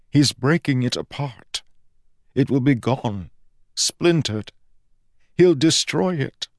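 lightly voiced anxious deity; distressed god. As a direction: climb in pitch as the fear grows. God panics over his power.

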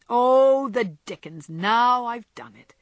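drawn-out, loud exclamation of dismay; dismayed outburst. Acted. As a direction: blurt it as if caught out. Laurie realises he slipped up.

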